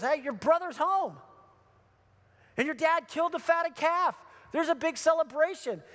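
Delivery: high, eager news-breaking; excited announcement. Retold or imitated. Servant telling older brother of the party, excited announcement. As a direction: burst out with the good news.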